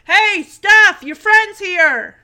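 loud, high, drawn-out call; imitated shout. Joey calls Stephanie about her friend at the door, shouting. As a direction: imitate Joey hollering across the house. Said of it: "So she didn't hear Joey shout".

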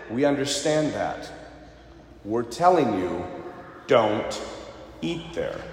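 low, quiet and deliberate, spaced out; grave warning. Retold or imitated. Locals warning the stubborn tourist, low and quietly grave. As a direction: lean in and spell out the danger.

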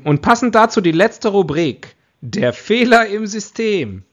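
high, loud, slow announcement; excited announcement. Real, not acted. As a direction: announce the segment with flair. Host announcing the final segment.

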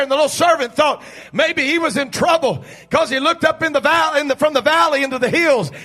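loud, driving sermon delivery; full-force preaching. Real, not acted. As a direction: preach it hard to a roused congregation.

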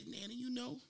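put-on high pleading little-kid voice; puppy face plea. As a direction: beg sweetly like a kid. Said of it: "with the puppy face".

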